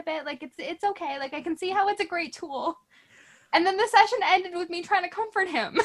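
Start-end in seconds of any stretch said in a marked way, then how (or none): 3.5-5.9 laughing through the line, a bit higher and louder; half laugh it out in disbelief; giggling as the story lands. Real, not acted. Laughing while telling the ending.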